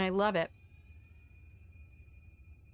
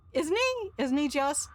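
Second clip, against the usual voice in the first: high gushing; 6 semitones higher than usual. Real, not acted. Mock-adoring reply.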